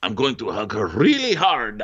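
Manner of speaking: mock-eager declaration, hammed up; Andrea. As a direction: imitate an over-keen suitor. Acts out Andrea hugging Paige.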